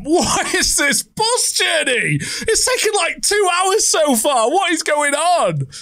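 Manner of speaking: high, slow, incredulous outcry; exasperated shout. Real, not acted. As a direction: rant at the endless ride. Exasperated at the long bus ride.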